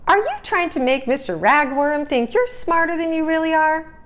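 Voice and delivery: a hint of a nosy little sister teasing her brother. D W voiced by reader.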